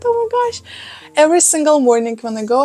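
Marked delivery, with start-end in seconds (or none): 0.0-0.6 excited squeal. Real, not acted. Proud exclamation.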